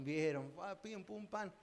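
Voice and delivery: ordinary talk, nothing marked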